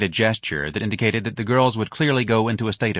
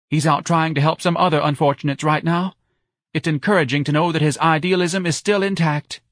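high-pitched, emphatic defence; pitched-up, insistent wife; earnest wife; 8 semitones higher, 4 dB louder than usual. A narrator's reading. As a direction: praise the idealist in a raised voice. Wife defending the young idealist.

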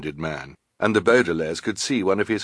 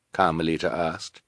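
ordinary delivery

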